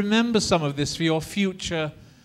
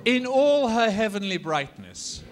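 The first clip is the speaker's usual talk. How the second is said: loud, high, drawn-out romantic declamation; heartfelt romantic ardor; 6 semitones higher, 5 dB louder, slower than usual. Shown as a demonstration. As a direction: declaim it slowly with swelling ardor. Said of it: "a bit more heartfelt".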